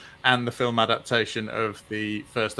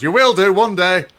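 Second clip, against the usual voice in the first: higher, louder put-on voice; comic punchline; 6 semitones higher, 6 dB louder than usual. Comedian telling the crowd they'll get it, in a louder put-on voice. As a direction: land the punchline in a character voice. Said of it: "He goes".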